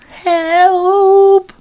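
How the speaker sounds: high loud shout; cry for help. Acted. Stuck Ginger shouts down from the tree, loud cry. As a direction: yell desperately.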